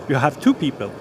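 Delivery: usual speaking voice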